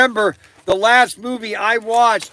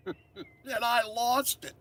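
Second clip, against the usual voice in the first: laughing; 3 semitones higher, 12 dB quieter, much slower than usual. Real, not acted. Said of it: "I am just laughing so loud".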